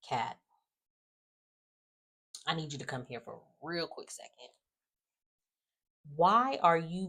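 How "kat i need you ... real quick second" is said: quiet aside. Pretend. Quiet aside addressing Kat.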